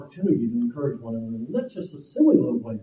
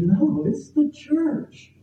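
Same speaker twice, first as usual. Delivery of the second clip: high, drawn-out "it's the church"; emphatic insistence; 12 semitones higher, much slower than usual. Retold or imitated. Ukrainian man correcting the interviewer about his team, emphatic insistence. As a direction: stretch out "it's the church" slowly, pitched up to insist.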